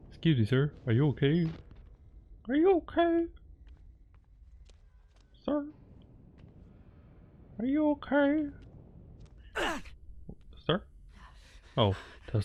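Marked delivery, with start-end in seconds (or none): 2.5-5.6 very high, thin character voice; squeaky high voice. Pretend. Voicing a character asking stranger. 7.6-10.8 very high, thin character voice; squeaky high voice. Pretend. Voicing a character asking stranger.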